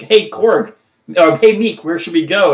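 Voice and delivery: breezy Thor asking the crew; imitating Thor. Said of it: "he's like".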